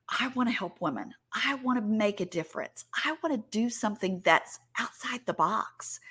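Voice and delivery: soft, near-whispered inner wish; hushed longing. Retold or imitated. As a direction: voice a private dream very softly. Voicing listeners' private wishes.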